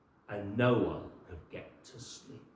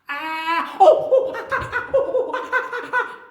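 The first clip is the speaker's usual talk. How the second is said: very high, loud ouch cries with laughs; bird yelps of pain; 16 dB louder than usual. Acted. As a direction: squeal as the sticks prick you. Birds pricked by sticks.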